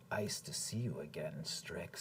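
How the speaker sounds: low, soft villain menace; quiet, gloating bad-guy voice; malevolent greeting. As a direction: sink to a hushed, malevolent purr. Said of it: "smile at you malevolently".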